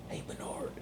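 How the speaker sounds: hushed whisper to the dog. Acted. Owner whispers his dog's name, hushed whisper. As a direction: breathe his name softly.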